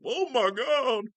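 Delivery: high, loud, drawn-out mock awe; hammed-up stunned gasp of delight; amazed customer. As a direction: wail it in exaggerated amazement.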